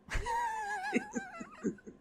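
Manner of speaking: shrieking laugh; high squealing laugh. Real, not acted. Laughing at the quote.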